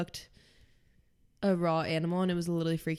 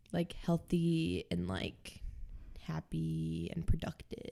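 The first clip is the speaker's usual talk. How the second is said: softer, fading to a whisper; trailing whisper; 3 semitones lower, 6 dB quieter, slower than usual. Real, not acted. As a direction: let it fade to a breathy hush.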